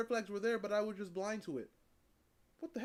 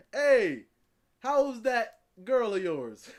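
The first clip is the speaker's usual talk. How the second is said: loud, slow folksy greeting; imitating Dog; 3 semitones higher, 9 dB louder, much slower than usual. Old man greeting him about his girlfriend, slow loud. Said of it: "if this man was like".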